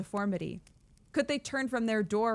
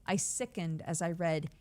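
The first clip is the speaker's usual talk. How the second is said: ordinary delivery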